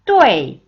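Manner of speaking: 뚜에이 as one loud syllable sliding sharply down; falling fourth tone. Shown as a demonstration. Teacher demonstrating fourth tone, 뚜에이 loud and falling. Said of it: "이렇게 들어갑니다".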